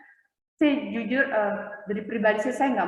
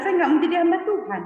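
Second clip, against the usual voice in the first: high, fast, louder protest; retold refusal; 8 semitones higher, 5 dB louder, faster than usual. Telling family she won't serve God, insistent refusal. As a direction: blurt out a retold objection, pitched up.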